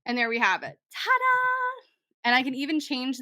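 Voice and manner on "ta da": high, bright sung-out ta-da; a little fanfare for the finished page; showy ta-da. Pretend. Host showing off her redone title to viewers, high ta-da. Reveals finished design again.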